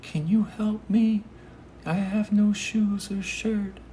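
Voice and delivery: imitated high-pitched plea; really high voice. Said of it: "he had a really high voice".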